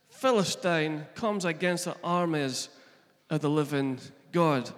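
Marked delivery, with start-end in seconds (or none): none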